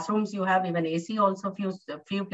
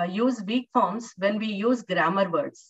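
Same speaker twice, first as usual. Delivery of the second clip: normal talking voice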